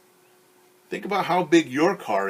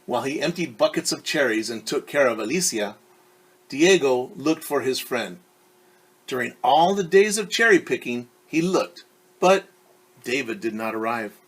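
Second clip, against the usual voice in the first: clear, lively read-aloud voice; storyteller reading a picture book; story narration; 6 dB louder than usual. A narrator's reading. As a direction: read it to a class of kids.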